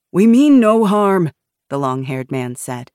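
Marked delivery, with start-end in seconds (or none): 0.1-1.3 raised, drawn-out appeal from a man at gunpoint; loud protest. A narrator's reading. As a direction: call out slowly and loudly to the guards. Man facing raised rifles protests.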